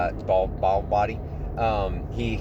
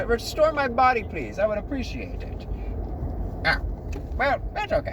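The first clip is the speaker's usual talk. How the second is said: high, polite little demon; put-on high demon voice; cacodemon character voice; 9 semitones higher than usual. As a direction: ask meekly in a squeaky creature voice. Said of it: "let me get the voice".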